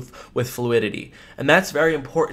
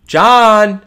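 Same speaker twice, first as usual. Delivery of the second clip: a shouted name, louder and higher; loud call; 7 semitones higher, 13 dB louder than usual. Shown as a demonstration. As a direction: bark the name out. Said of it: "is like".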